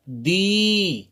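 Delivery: दी with the ई held long; teacher models a long दी. Shown as a demonstration. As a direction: draw out the vowel in दी. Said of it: "देर तक इसे बोलेंगे".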